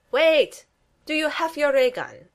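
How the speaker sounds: calling down the hall. Acted. Gru calling to his daughter down the hall, loud. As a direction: call out after her from far off. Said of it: "Gru calls down from down the hall".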